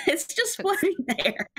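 high, slow, through giggles; can barely get it out for laughing. Real, not acted. GM cracking up describing shoe.

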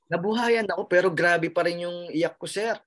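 ordinary delivery

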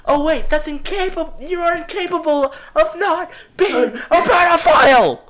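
exasperated rant rising to a loud scream; building to a scream. Real, not acted. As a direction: start heated and end screaming the last word. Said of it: "I just screamed the word pedophile".

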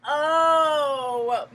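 crying moan of a sick snake. Acted. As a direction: groan it out like a tummy ache. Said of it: "moaned the snake".

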